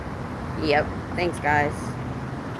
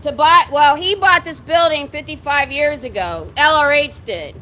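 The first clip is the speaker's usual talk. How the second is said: drill sergeant voice. Real, not acted. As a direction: shout it out to the building. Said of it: "I'm using my drill sergeant voice".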